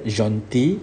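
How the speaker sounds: Gentil said as zhon-TEE, with no l at the end; French gentil, final l silent. Shown as a demonstration. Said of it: "You don't pronounce the L here".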